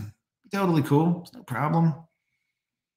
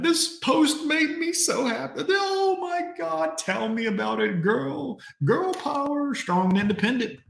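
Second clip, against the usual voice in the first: high mock girly voice gushing over the post; mocking gushing woman; 11 semitones higher than usual. Streamer mocks women commenters in a high squealing voice. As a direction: squeal it like a fan girl, sarcastically. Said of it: "Other women".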